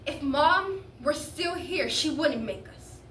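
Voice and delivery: drawn-out, pointed reproach; insistent protest. Acted. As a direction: press each word home slowly. Teen presses the protest home.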